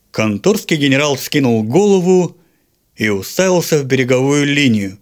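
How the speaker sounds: ordinary delivery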